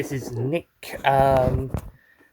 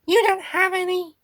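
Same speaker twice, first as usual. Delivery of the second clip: very high little creature voice; Meep character voice; 12 semitones higher than usual. Acted. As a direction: squeak it in a Meep voice with an odd accent. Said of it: "I am beat the meep".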